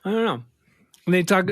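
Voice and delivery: usual speaking voice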